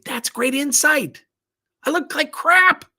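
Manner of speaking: high, slow, loud put-on sweetness; mock gracious reply. Imagined reply to an insult about his shirt, mock sweet. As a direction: gush with fake gratitude.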